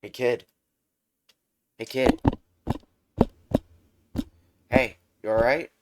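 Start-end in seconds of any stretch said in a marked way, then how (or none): 0.0-0.5 a first call, louder than talk. Acted. Hero waking fallen kid. 1.8-2.2 louder, higher call to wake him; calling louder when the first call fails. Acted. Hero waking fallen kid.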